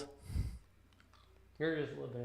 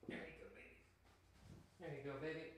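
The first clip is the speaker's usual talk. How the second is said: hushed, fond praise; soft pet praise; 2 semitones higher, 17 dB quieter, faster than usual. Real, not acted. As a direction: murmur it to your pup as a reward.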